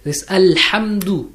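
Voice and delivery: Alhamdu slowly, the h clearly sounded; slow correct Alhamdu. Shown as a demonstration. Teacher to student, slow model word. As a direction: lean on the h in Alhamdu. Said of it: "It's Alhamdu".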